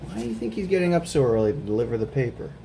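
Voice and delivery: ordinary talk, nothing marked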